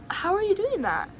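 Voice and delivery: high, baffled question; retold disbelief. Marveling that they fear nothing, retold disbelief. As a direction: ask it high, in mock amazement.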